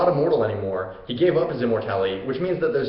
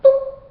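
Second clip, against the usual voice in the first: tiny very high-pitched boop; short squeaky shot noise; imitated arrow shot; 8 dB louder than usual. Hercules fires his arrow at the boar, cute boop. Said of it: "and is just like".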